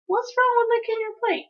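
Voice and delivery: high-pitched challenge; high, drawn-up "what's wrong with that"; indignant protest. A narrator's reading. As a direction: argue back like a put-out kid. Child argues about manners.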